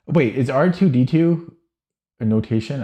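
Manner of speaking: suddenly unsure, ask it high; startled double-take question; sudden doubt. Real, not acted. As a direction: pitch jumps up in surprise. Sudden doubt about chess notation.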